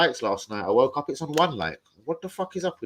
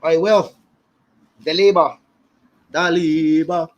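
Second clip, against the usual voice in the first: loud shout-out to a friend; loud call out; 2 semitones higher, 9 dB louder, slower than usual. Real, not acted. Calling out to friends on the stream, loud.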